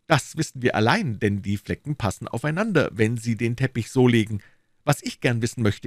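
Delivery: a self-satisfied detective pleased with himself; Lestrade, smug inspector. Acted. Lestrade voiced by narrator.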